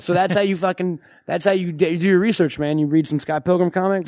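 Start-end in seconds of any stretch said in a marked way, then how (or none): none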